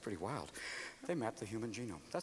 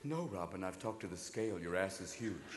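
fridge voice; 13 semitones lower, 18 dB quieter than usual. Retold or imitated. Fridge scolding Robin.

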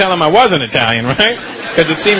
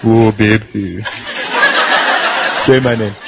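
very low, amorous man's voice; mock seduction, pitched right down; lover in bed; 9 semitones lower, slower than usual. The man making love with his woman, mock-amorous low voice. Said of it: "he's in there with his woman".

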